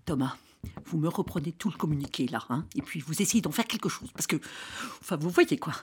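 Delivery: quick low-voiced aside to an assistant; hushed aside. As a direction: murmur it on the side so others can't hear.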